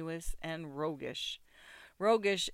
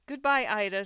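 louder, higher, cheery send-off; laughing farewell; 5 semitones higher, 8 dB louder than usual. A narrator's reading. Mother-in-law calls goodbye to the bride, laughingly. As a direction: call it out brightly, a touch of laughter. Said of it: "said the bridegroom's mother with a laugh".

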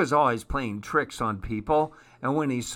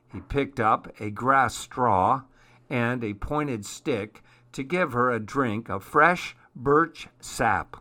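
plain reading voice

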